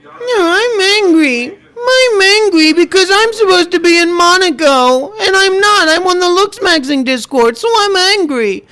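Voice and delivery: very high, wailing spoiled-brat imitation; hammed-up tearful whine; mock whining. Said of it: "Stop whining".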